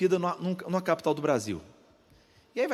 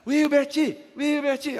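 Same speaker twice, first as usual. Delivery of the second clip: high, loud mock cheer of the name; a playful imagined yell of Wilbert; 9 semitones higher, 5 dB louder, much slower than usual. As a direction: call the name like a wife cheering from the stands. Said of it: "Então ela podia gritar".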